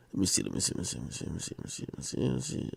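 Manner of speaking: fading to an unvoiced mumble; muttering to self. Real, not acted. Host scrolling for the clip, muttering under his breath. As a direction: mumble it while searching.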